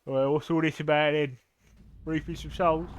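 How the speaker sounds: lightly hammed-up Cockney crow; Cockney geezer. Cockney crow asking about soul reaping, light geezer accent. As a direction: play a nosy Cockney geezer. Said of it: "The Cockney geezer crows".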